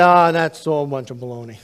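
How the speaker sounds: loud scornful dismissal; scoffing. Retold or imitated. Man scoffing at talk of death, loud and dismissive. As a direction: wave it off with scorn.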